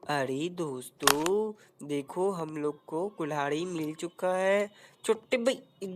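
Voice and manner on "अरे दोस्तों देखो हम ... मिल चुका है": much lower, slow announcement; slow low mock trailer voice; deep announcer voice. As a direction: drop low and drag it out to the viewers. Deep announcer aside to viewers.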